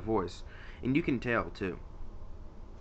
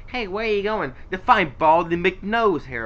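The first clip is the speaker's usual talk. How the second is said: loud, higher cartoon banter; animated imitation of a cutscene line; quoting Sonic; 6 semitones higher, 7 dB louder than usual. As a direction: call out teasingly, stretching the silly name. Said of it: "A little bit later in the cutscene".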